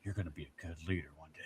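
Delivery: quoted adult. Retold or imitated. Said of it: "the one person was like".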